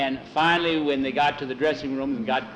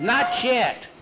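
higher-pitched rebuke; insistent, raised-voice reminder; sharp correction; 9 semitones higher than usual. A narrator's reading. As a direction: cut the celebrating short. Teammate curbs the celebrating.

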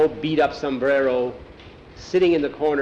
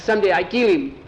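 higher, raised vow of revenge; impassioned vow; 5 semitones higher, 4 dB louder than usual. A narrator's reading. Boy whose father was killed, impassioned vow. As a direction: swear it with heat.

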